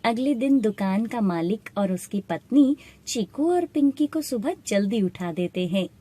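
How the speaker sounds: ordinary delivery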